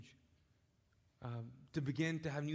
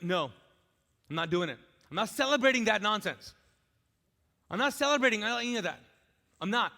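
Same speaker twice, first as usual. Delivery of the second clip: louder, higher, defiant refusal; stubborn refusal; 4 semitones higher, 9 dB louder, faster than usual. Retold or imitated. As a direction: dig your heels in and refuse. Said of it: "You're like".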